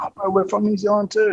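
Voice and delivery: high, eager pleading; excited. Retold or imitated. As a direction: sell it with excitement. Retold eager plea to player.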